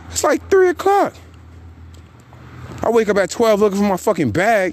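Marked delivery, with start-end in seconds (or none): 0.1-1.1 three o'clock pitched way higher in disbelief; incredulous high squeak; squealed disbelief. Real, not acted. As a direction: shriek the time out, unable to believe it. Outraged at the late hour.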